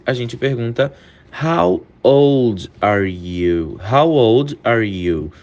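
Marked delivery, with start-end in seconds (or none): 1.4-3.8 very slow and low, spaced words; slow careful model question; slow demo. Shown as a demonstration. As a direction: lay it out slowly for students. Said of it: "repeated at normal pace".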